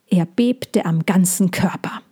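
ordinary delivery, nothing marked

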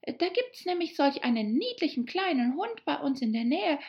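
put-on high, lilting girl's chatter about a dog; eager. A narrator's reading. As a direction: gush on keenly, carried away. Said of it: "Alice fuhr eifrig fort". (eifrig schwärmende Mädchenstimme)

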